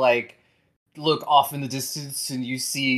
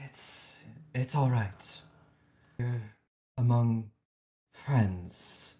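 gentle reassurance; 2 semitones lower, 7 dB quieter than usual. Acted. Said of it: "Winston goes".

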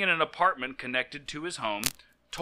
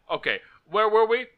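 normal talking voice

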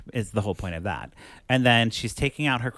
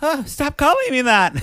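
laughing protest; 7 dB louder than usual. Retold or imitated. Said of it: "And she's like".

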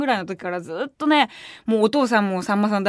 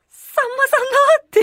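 much higher, louder, slow starstruck gasp; starstruck awe; 5 dB louder, slower than usual. Retold or imitated. Fan meeting her childhood idol backstage, starstruck gasp. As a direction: blurt it out in stunned awe. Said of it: "震えちゃって".

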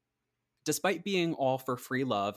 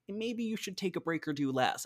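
higher-pitched, tentative voicing of worried friends; concerned suggestion; 8 semitones higher than usual. Worried friends advising her about her health, concerned suggestion. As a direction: lightly imitate friends urging someone to ease off.

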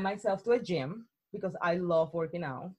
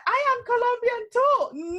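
excited priest; 6 dB louder than usual. Retold or imitated. Priest's delighted reply retold.

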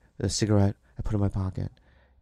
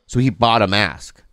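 ordinary delivery

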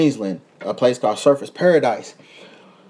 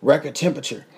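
normal talking voice

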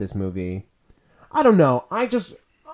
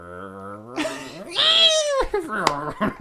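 car noise; 8 semitones higher than usual. Shown as a demonstration. Host taking a turn at best car noise, engine imitation. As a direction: rev up from a growl into a squeal. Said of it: "What kind of car do I wanna be?".

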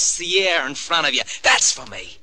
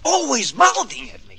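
resentful outburst; 11 semitones higher than usual. Acted. Son resents mother's lecturing.

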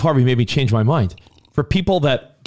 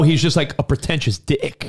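usual speaking voice

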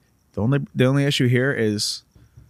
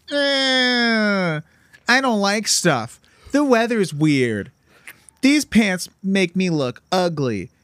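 high, slow whiny complaining; whiny person; 9 semitones higher, much slower than usual. Retold or imitated. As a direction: whine every word like a spoiled brat. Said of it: "Good impression of a whiny person".

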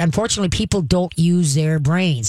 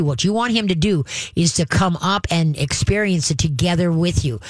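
ordinary talk, nothing marked

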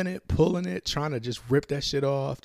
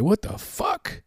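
shocked; 8 semitones higher, slower than usual. Retold or imitated. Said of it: "I was like".

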